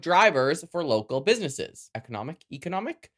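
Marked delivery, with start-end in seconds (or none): 1.9-3.1 economic with a long E, questioning rise; saying economic as ee-conomic, puzzled; slipping into a Canadian way of saying economic; odd pronunciation. Real, not acted. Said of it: "Why did I say it like that?".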